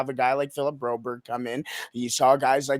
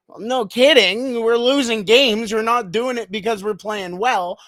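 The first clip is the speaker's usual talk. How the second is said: higher, louder imitation of frustrated players; imitating players' exasperated comeback; mock exasperated retort; 7 semitones higher, 5 dB louder than usual. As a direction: fire back as the fed-up team. Voicing players' retort to coach.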